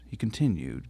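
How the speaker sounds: ordinary delivery, nothing marked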